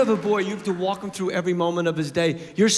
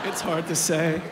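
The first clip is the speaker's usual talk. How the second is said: lower, weary mumble; defeated kid mumble; 4 semitones lower than usual. Retold or imitated. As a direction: sigh it out, worn down. Son's defeated reply third time.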